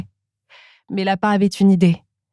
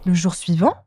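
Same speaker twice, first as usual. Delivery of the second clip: ordinary delivery, nothing marked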